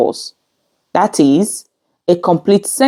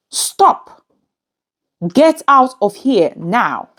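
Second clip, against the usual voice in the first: higher-pitched order at normal volume; command; 4 semitones higher, slower than usual. Shown as a demonstration. Teacher giving example commands to learners, demonstrated order. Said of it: "it is used to give commands".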